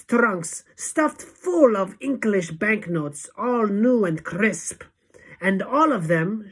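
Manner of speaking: loud, high witch's boast; Grand High Witch shout. A narrator's reading. Witch leader boasting of money, loud shout. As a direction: declaim it forcefully, lingering on the riches. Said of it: "shouted the Grand High Witch".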